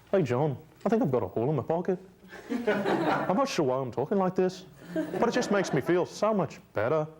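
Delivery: mock Liverpool accent, stressing the wrong words; [name] impression. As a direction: send up a Liverpool accent with oddly placed emphasis. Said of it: "these guys ... emphasize the wrong part of every sentence".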